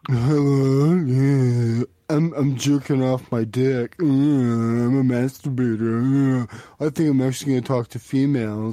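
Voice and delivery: low, slow, drawn-out dopey voice; mock creepy caller. Mimicking a creepy caller to berate him.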